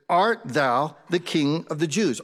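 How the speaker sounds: put-on slow, measured old-English question; deliberate scripture reading. Shown as a demonstration. Preacher quotes Pilate to the congregation, slow and deliberate. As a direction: read it out slowly, word by word. Reads scripture, then rewords it.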